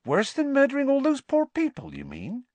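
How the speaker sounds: very high, incredulous question; alive with curiosity. A narrator's reading. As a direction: probe eagerly for the secret. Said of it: "her voice alive with curiosity".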